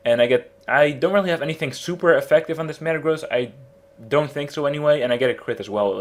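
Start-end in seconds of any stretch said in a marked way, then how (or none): none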